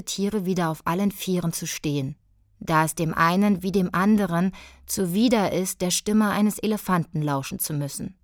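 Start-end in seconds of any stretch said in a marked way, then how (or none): none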